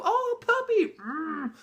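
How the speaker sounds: very high squealed puppy adoration; gushing over puppy. Retold or imitated. People rush up to hug the dog, high-pitched gushing.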